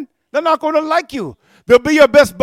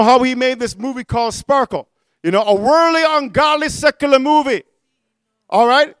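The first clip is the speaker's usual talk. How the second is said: normal talking voice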